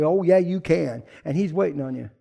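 ordinary talk, nothing marked

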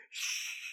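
soft, breath-only hushing sound; soft shush. Acted. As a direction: hush someone quietly. Repeats the shush more softly.

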